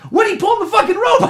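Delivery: high-pitched frustrated yell; acted-out outburst at the top of the range; exasperated shout. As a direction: yell at a useless game character. Acted outburst at frozen game character.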